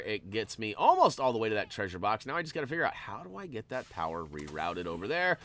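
ordinary delivery

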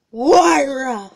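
gasp, then a loud cry of her name; shocked gasp. Acted. Friend bursting in on the scene, shocked and loud.

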